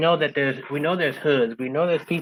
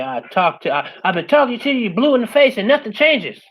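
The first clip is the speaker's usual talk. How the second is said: higher-pitched fed-up parent, rising in volume; exasperated parent; 7 semitones higher, 5 dB louder than usual. Retold or imitated. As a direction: imitate a parent at the end of their patience. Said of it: "your mommy and dad tell you".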